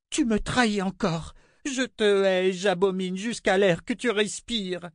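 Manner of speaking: high, spitting queen's voice; hateful queen. A narrator's reading. Queen cursing the girl who betrayed her. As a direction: hiss out hatred.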